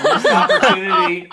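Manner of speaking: loud laughing exclamation. Real, not acted. Judge laughing at a missed bourbon joke. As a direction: blurt it out through a laugh.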